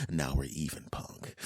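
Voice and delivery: low, soft, velvety crooner; smooth voice. Retold or imitated. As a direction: purr it out like a smooth DJ. Same cool voice in the fantasy.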